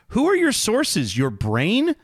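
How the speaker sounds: mock the reporters with a pointed question; sarcastic disbelief. Real, not acted.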